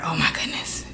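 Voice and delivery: breathless whispered shock. Retold or imitated. Retelling her stunned reaction to the cousin, whispered. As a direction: whisper it as your heart sinks.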